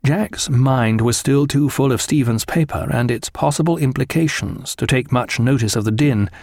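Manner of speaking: usual speaking voice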